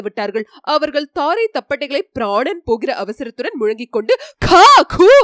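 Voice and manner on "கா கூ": loud, high whoops; hunters' whooping shout. A narrator's reading. Hunters beat drums and rush at the boars, whooping shouts. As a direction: holler to scare off the beasts. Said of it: "என்று கூச்சலிட்டார்கள்".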